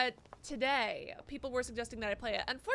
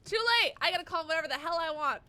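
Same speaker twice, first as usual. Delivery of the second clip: high, loud retort; defiant outburst; 4 semitones higher, 8 dB louder, faster than usual. Real, not acted. Player answering chat's criticism, defiant outburst. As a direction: fire back loudly and high.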